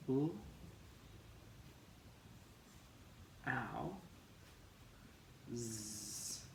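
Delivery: bl, ow, z said apart; sounding out blouse. Shown as a demonstration. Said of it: "And we say b".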